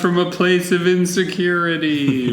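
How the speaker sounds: high, slow, hollow ghost wail; creepy ghost voice. Pretend. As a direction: draw it out like a ghost calling from beyond. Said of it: "In your creepy ghost voice".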